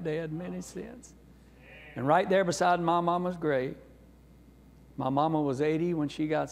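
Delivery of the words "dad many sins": slower, softer repeat of many sins; soft, slow agreement; gentle echo. Retold or imitated. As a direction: say it back tenderly to dad. Echoing dad's words back gently.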